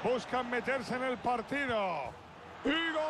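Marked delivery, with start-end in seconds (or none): 2.6-3.1 excited goal call. Real, not acted. Goal called.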